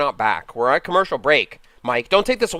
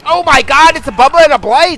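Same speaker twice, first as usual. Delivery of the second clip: much higher, much louder hammed-up commentator shock; excited outburst; 10 semitones higher, 9 dB louder than usual. Mock sportscaster reacting to two killers, much higher and louder. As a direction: gasp out the bad news like a commentator.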